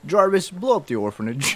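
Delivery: normal talking voice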